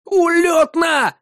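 high, drawn-out fangirl shout; squealing fan. A narrator's reading. Bartender thrilled by the music, squealing like a fan. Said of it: "заверещал, словно типичная фанатка би ти эс".

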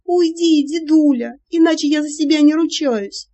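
high, loud shriek. Acted. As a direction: shriek it in panic. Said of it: "я провизжала".

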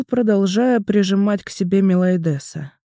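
plain reading voice

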